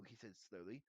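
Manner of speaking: ordinary delivery, nothing marked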